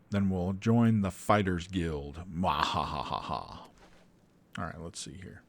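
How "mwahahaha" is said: mad scientist laugh. Pretend. Gleeful after planning to join guild.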